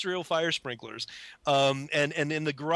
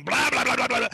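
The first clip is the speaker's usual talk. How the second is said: confident, louder mock drill noise; 8 semitones higher, 5 dB louder than usual. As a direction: vocalize the drill going hard. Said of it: "I was going".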